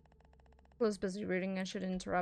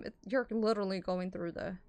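usual speaking voice

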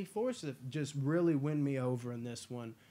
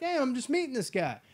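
higher, louder incredulous put-on; mock alarmed; 10 semitones higher, 5 dB louder than usual. Viewer meeting the villain, high mock alarm. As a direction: act freaked out meeting him. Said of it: "You're like".

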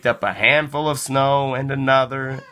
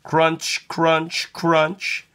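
crunching footsteps; 5 semitones higher, much slower than usual. A narrator's reading. Footsteps in snow, read aloud.

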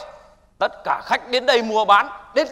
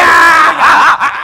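laughing shouts; 5 semitones higher, 9 dB louder than usual. Acted. Scuffle erupts, laughing shouts.

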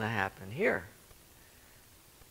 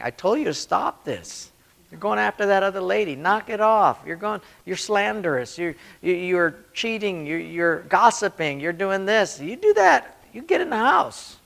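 higher, louder scolding voice building to a yell; God scolding his children, louder and higher; God as scolding parent; 7 semitones higher, 6 dB louder than usual. Retold or imitated. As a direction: scold like a fed-up father.

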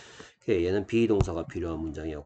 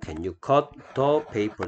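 very slow, word by word; 천천히 또박또박 읽어 주기; slow model reading; much slower than usual. Shown as a demonstration. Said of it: "teacher models the question". (아주 느린 시범 읽기)

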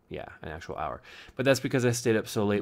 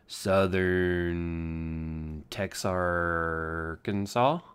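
Southern drawl; much slower than usual. Pretend. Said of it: "Maybe it would be from".

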